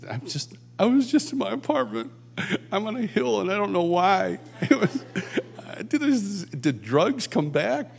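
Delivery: high, dazed mock confusion; a lightly voiced befuddled man on a hill; bewildered retelling. Friend recalls waking on a hillside, dazed and high-voiced.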